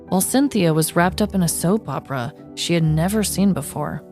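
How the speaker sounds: usual speaking voice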